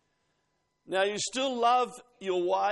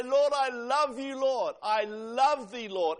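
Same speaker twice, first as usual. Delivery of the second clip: louder, higher heartfelt praise; 4 semitones higher, 4 dB louder, slower than usual. Shown as a demonstration. Preacher showing how to tell the Lord, heartfelt. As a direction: pour your heart into it. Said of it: "No, you say".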